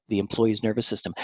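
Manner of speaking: normal talking voice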